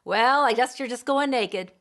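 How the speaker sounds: loud, dry mock; sarcastic. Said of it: "Or there's sarcasm".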